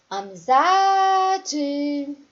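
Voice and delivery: "Amizade" half-sung, ZA held very long; sing-song syllable stretch. Shown as a demonstration. Teacher showing pupils the stressed syllable of Amizade, sing-song stretch. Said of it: "como se você estivesse cantando".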